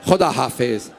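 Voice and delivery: low, hushed farewell; soft voice. Acted. Mother's last soft goodbye to her son, hushed. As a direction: speak it softly, almost to yourself. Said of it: "एक धीमे आवाज में कहा".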